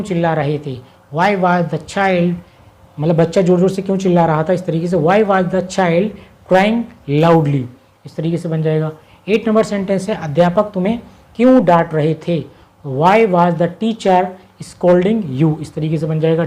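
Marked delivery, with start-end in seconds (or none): 1.1-2.3 slow dictation. Shown as a demonstration. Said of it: "teacher reads translation". 5.0-7.6 slow dictation. Shown as a demonstration. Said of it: "teacher reads translation". 12.9-15.6 slow dictation. Shown as a demonstration. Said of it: "teacher reads translation".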